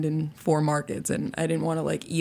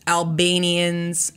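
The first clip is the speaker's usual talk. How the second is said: usual speaking voice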